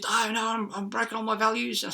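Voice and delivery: high-pitched imitated protest; mock distress. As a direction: mimic someone fretting over broken principles. Acted distressed protest about eating steak.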